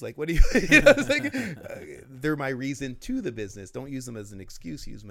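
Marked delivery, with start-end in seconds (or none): 0.0-1.3 bursting into laughter mid-quote; laughing. Real, not acted. Host mocks the children excuse, laughing loudly. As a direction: laugh loudly through the words.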